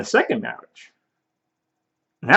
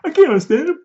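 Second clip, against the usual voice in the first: mock exasperation; 12 semitones higher than usual. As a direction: throw up your hands, higher. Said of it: "What's he saying?".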